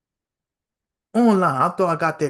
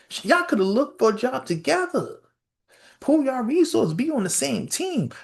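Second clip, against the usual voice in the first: exasperated rant; 11 semitones higher than usual. Real, not acted. Comic exasperated rant, high.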